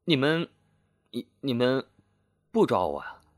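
halting, broken-up stammer; stammering question. A narrator's reading. Stunned fugitive questioning the police chief, stammering. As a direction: stumble over the words in disbelief, pausing between attempts. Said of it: "半晌才结结巴巴地问".

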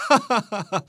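ringing acted "ha ha ha"; hearty laugh. A narrator's reading. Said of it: "大明朗声笑道".

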